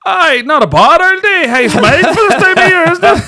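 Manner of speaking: hammed-up cheery remark to cover the awkwardness; mock breezy small talk. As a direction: play it cool with a cheeky grin. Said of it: "I was like".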